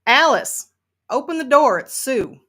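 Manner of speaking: calling out. Acted. Sue calls to Alice through the door, loud and insistent. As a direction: demand entry through a closed door.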